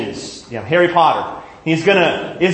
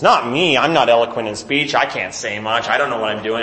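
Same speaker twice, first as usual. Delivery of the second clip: meek reluctant Moses; 6 semitones lower than usual. Acted. Moses pleading unworthiness, acted.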